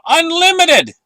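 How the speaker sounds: excited exclaim. Real, not acted. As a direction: shout the word Unlimited with thrill. Hyping gold and silver.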